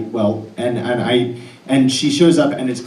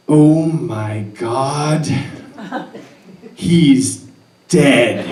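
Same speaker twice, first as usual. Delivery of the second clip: slow, loud, higher cry of alarm; panicked yell; 5 semitones higher, 7 dB louder, much slower than usual. Retold or imitated. Woman at the cliff bottom yelling up in horror, loud panicked cry. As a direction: scream it down the cliff, drawn out. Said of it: "yelling out from the bottom of that cliff".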